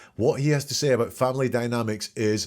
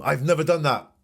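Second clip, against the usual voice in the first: higher and louder, flatly insistent; emphatic blanket denial; 6 semitones higher, 5 dB louder than usual. Retold or imitated. Analyst mimics a witness flatly denying everything, emphatic. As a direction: slam the door with a total denial. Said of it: "talk in this absolute".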